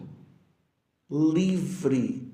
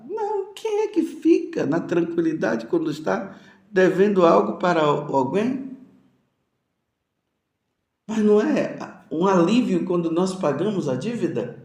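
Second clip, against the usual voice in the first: usual speaking voice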